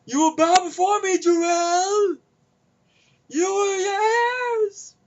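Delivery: high, loud villain proclamation; dark lord. Retold or imitated. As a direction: command it like a hammy tyrant. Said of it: "in their first Superman movie".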